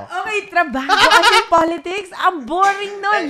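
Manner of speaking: loud, high protest broken by laughter; laughing protest. Real, not acted. Host teasing guest about boring politics talk, loud laughing protest. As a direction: complain loudly through a laugh.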